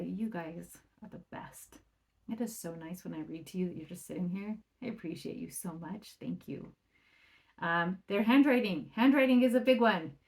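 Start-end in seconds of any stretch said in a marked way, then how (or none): 0.0-6.7 tender praise. Retold or imitated. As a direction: gently thank the kids for sitting still. Parent praising quiet kids.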